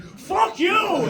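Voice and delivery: very loud, high Fuck you through laughter; sudden shout. Retold or imitated. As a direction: bellow it out of nowhere like Tony Soprano. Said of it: "Tony Soprano going".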